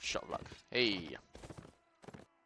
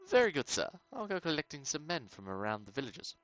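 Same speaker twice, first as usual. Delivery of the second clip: very good sir, pitched higher; a deferential retainer answering his lord; lightly voiced hireling; eager servant reply; 6 semitones higher, 4 dB louder than usual. Player reply read aloud.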